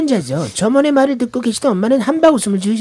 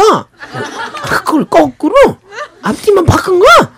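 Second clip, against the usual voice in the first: surprised; 8 semitones higher, 5 dB louder, slower than usual. Retold or imitated. Mom surprised at reversal.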